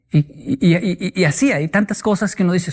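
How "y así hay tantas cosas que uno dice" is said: ordinary talk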